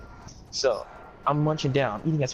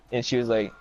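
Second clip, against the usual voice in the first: ordinary delivery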